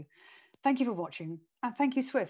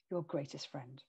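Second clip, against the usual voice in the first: soft and quiet; 5 semitones lower, 10 dB quieter than usual. Shown as a demonstration. Coach demonstrates space and quiet.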